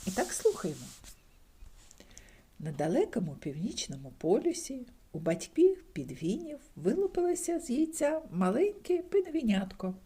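ordinary delivery, nothing marked

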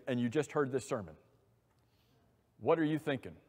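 ordinary delivery

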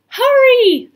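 high, loud urging cry; urgent call. A narrator's reading. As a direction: call out to rush them along. Annie urges the others on.

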